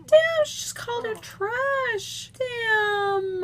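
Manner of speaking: high-pitched amazed exclamations; shocked reaction. Real, not acted. Gamer hearing one character insult another, shocked.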